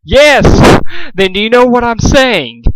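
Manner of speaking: high, loud demanding yell; shouting. A narrator's reading. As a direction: scream it in his face. Pinkamena shouting at captive.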